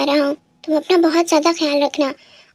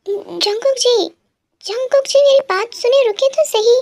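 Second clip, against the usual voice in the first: breathless calls after a departing husband; calling after him; 6 semitones higher than usual. Acted. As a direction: chase him down calling his name.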